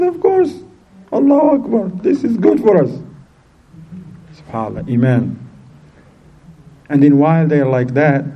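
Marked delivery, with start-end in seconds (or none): 0.0-2.9 high-pitched, upbeat reply; retold cheerful assurance. Daughter answers her hungry family, cheerfully assured. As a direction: act out her untroubled faith.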